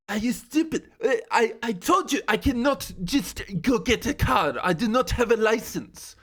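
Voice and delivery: mock French accent, exasperated. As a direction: huff at him in a put-on French accent. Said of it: "a French accent ... mimic her yelling at me".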